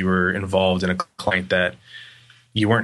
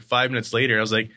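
normal talking voice

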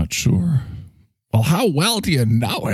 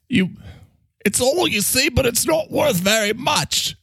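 high, bubbly Mike-the-Mike figure; cheery high voice; 12 semitones higher than usual. Acted. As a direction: gush about him in a high voice.

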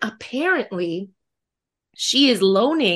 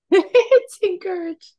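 laughing; 9 semitones higher than usual. Real, not acted. Laughing echo of friend.

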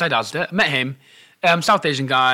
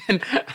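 laugh; 6 semitones higher than usual. Real, not acted. Laughs recalling the scene.

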